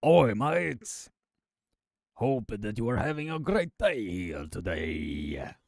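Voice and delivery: a clumsy put-on Scottish accent, greeting the mates; hammed-up Scottish accent, half joking; greeting viewers in a deliberately terrible Scottish accent; bad Scottish accent. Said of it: "worst, Scottish accent that I have ever done".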